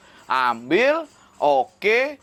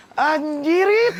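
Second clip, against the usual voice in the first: disbelief; 13 semitones higher, 4 dB louder, much slower than usual. Real, not acted. Disbelief at clown job.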